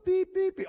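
high, loud electronic beeps; imitated detector beeps. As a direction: sound like a detector going off. Mimics a treasure detector going off.

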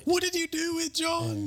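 very high, hammed-up accusation; mock outraged cry. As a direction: wail the question in comic outrage. Mock confrontation with [name].

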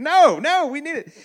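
high-pitched comic plea, loud at first; playful, squeaky-high objection; mock protest. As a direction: blurt it out in mock panic. Acted reluctance to return money.

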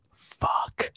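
retold schoolkid's secret whisper; imitated whisper. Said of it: "whispered in my ear".

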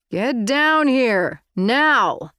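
loud yell up the stairs. Acted. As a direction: shout it upstairs, slow and loud. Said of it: "he yelled upstairs".

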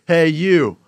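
imitated customer getting someone's attention; calling out. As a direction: call out to a stranger. Customer calling out over counter.

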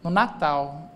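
low, awkwardly tactful mother-in-law; embarrassed. Retold or imitated. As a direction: imitate her uneasy, careful remark. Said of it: "ela falou de um jeito constrangido".

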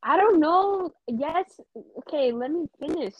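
high, loud exasperated outburst; flustered, cut off mid-outro. Real, not acted. Flustered at interruption.

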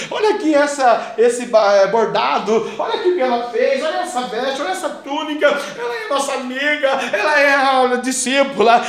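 lightly voiced pleading widows. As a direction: beg Peter to help, half-playful. Widows pleading with Peter, acted.

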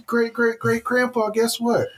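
high, teasing taunt. Pretend. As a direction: needle the old ancestor. Mocking ancestor as immortal.

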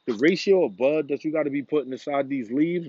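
ordinary delivery

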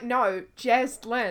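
"Jaslyn" drawn out in two slow parts; emphatic correction. Retold or imitated. Imitating friend correcting her name, slow emphatic correction. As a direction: spell out "Jaslyn" slowly, syllable by syllable. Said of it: "And you're like".

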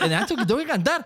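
high-pitched protest with laughter in it; hammed-up exasperated appeal; mock pleading protest. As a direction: plead with a bandmate, half laughing. Acted protest to bandmate.

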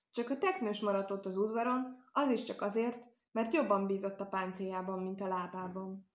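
ordinary delivery, nothing marked

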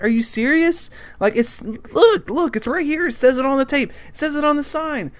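high-pitched, incredulous exasperation; frustrated. Retold or imitated. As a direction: plead the obvious to someone who missed it. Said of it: "I'm just so frustrated at this guy".

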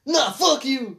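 high, loud retold snap; mock angry refusal. Retelling the old man refusing help, mock angry outburst. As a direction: imitate an indignant old man.

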